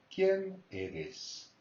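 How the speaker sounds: quién pitched high, eres falling; high quién question. Shown as a demonstration. Teacher demonstrating the question tune, quién high. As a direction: start ¿Quién eres? high on quién and let it fall. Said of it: "so it goes up in pitch".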